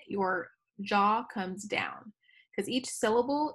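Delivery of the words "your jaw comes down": slow demo. Shown as a demonstration. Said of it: "say it out loud".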